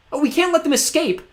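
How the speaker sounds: desperate plea; cried. A narrator's reading. Klaus urges sisters to chase, crying out. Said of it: "Klaus cried".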